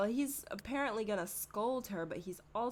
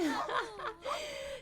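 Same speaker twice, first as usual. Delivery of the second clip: high squealing laugh. Real, not acted. Laughing at poor Harmony.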